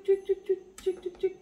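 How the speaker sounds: high squeaky cuik noises; squirting sound effect. Retold or imitated. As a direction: squeak like a squeezed sauce bottle. Squeezing sauce, imitated squirt sound.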